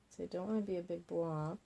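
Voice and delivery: ordinary talk, nothing marked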